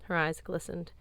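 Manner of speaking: ordinary delivery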